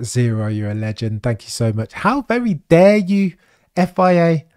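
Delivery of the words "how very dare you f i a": camp catchphrase indignation; theatrical put-upon outrage; mock indignation. Catchphrase aimed at FIA.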